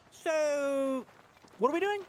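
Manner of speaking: high-pitched mock Australian impression; mimicking co-host. Impersonating the co-host on request, high mock accent. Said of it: "Do an impression of me".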